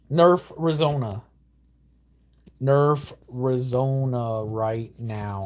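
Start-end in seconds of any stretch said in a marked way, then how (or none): 2.6-5.4 slow, drawn-out demand; lingering plea to the devs; slow insistence. Real, not acted. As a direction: drag each word out. Demanding a nerf.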